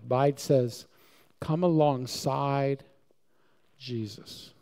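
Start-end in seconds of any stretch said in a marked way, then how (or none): none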